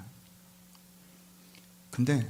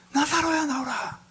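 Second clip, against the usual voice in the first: very high, ringing acted shout; loud calling out; slower than usual. Jesus calls Lazarus out of the tomb, loud high shout. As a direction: call the dead man out with a big raised cry. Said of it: "큰 소리로 외칩니다".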